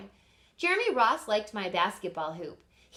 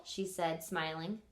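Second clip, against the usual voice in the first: usual speaking voice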